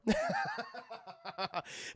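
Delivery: high, soft run of laughter; real laugh. Real, not acted. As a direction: laugh lightly at your own joke. Laughs at own drug joke.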